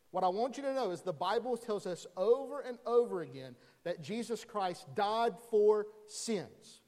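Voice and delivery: usual speaking voice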